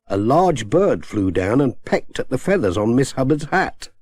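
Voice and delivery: ordinary delivery, nothing marked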